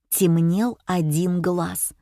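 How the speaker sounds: plain reading voice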